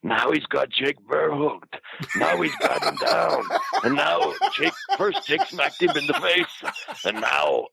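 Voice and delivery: high, stretched-out play-by-play impression; hammed-up ringside commentator; Bushwhacker's commentary. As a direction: narrate the fight slowly in a high, strained voice. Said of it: "narrating everything to everybody".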